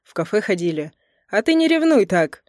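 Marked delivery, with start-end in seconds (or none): 1.3-2.4 higher, louder teasing lilt; amused teasing. A narrator's reading. Volgin teasing a jealous husband, amused and high. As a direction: tease with a lift in pitch. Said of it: "засмеялся Волгин".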